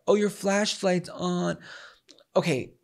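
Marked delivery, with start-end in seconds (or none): 0.1-1.6 slow, drawn-out stranger voice; mock helpful stranger. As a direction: mimic a smug stranger. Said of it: "And I".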